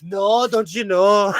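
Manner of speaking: put-on slow, playful Minnesota accent. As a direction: drag out the Minnesota O as a joke. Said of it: "You're not from Minnesota".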